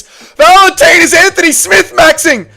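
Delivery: very high, loud disbelieving shout; shocked yelling. Real, not acted. Streamer yells at fighter on screen, shocked shout.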